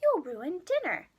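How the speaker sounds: lightly voiced scolding mother; mom scolding. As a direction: shoo the kid outside. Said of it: "his mom replied".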